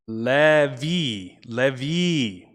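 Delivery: Levi said as "leh-vee", vowels drawn out; pronunciation demo. Shown as a demonstration. Streamer trying out a chat member's name, slow pronunciation demo. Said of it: "It's pronounced".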